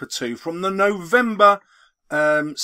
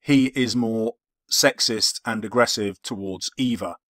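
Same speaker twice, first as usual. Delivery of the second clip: dictating; 5 semitones lower than usual. Acted. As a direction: spell out each punctuation mark aloud. Dictating an essay into Word.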